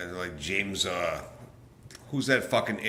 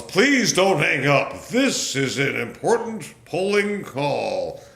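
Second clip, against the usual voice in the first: slow, higher, louder mock recorded voice; robocall voice; 7 semitones higher, 7 dB louder, slower than usual. Vlogger mocking a robocall that rings his cell. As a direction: parody an automated polling call. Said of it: "them annoying freaking bullshit calls".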